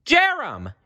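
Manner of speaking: very high frightened call; alarmed cry. Acted. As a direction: cry out as he falls. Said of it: "Elizabeth cried".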